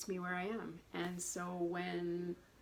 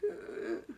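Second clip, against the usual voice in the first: distressed ugh; 11 semitones higher than usual. Retold or imitated. Anxious patient before procedure.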